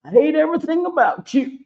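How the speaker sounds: high, indignant put-on voice for the eye; a petty little eye declaring its hatred; voicing the hateful eye. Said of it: "This one is like".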